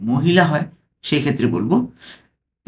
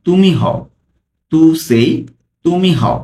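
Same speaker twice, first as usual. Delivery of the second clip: ordinary talk, nothing marked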